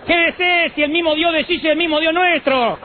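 high, insistent pleading to stay home; mock persuasion. Acting out excuse to the man.

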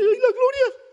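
squealing high excited gasp of joy; a giddy thrilled exclamation; high mock rapture; delighted cry.

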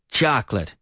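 CHOCOLATE as CHOK-lit; two-syllable pronunciation. Shown as a demonstration. Teacher repeating the corrected word, two syllables. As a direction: say chocolate without its middle vowel. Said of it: "talking about two-syllable words".

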